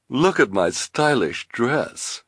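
high, slow, affected woman's voice; enticing villainess. Acted. Esme to Carmelita, enticing. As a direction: show off and draw it out.

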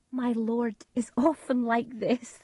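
calm the guests with a smile; Lady Macbeth. A narrator's reading. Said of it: "Lady Macbeth said to the guests".

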